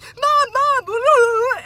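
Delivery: put-on high, loud whining child; whiny kid calling Mom. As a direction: wail for Mom like a needy kid. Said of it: "if my kids are like".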